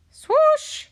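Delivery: high, loud whooshing sound word; loud swoosh. A narrator's reading. Reader voicing the ship setting off, loud swoosh. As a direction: whoosh it out like rushing wind.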